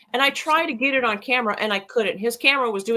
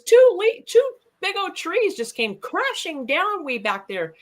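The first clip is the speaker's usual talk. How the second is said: excited report; 9 semitones higher than usual. Retold or imitated. Shelly tells him about crashing trees, excited and high. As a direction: blurt it out excitedly.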